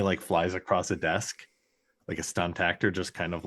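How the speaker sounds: ordinary talk, nothing marked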